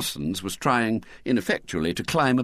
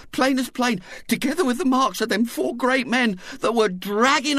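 tearful Missus Bott. A narrator's reading. Missus Bott tearfully telling her husband of the kidnapping. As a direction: quaver it out like a frightened mother.

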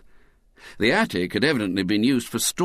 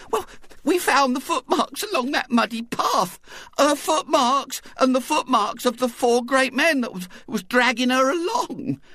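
tearful Missus Bott. A narrator's reading. Missus Bott tearfully telling her husband of the kidnapping. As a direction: quaver it out like a frightened mother.

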